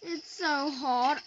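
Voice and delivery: ordinary delivery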